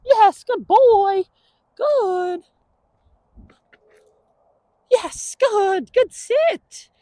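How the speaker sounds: slow, high praise; praise for dog. Real, not acted. Trainer praising dog's sit, high-pitched. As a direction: coo approval up high.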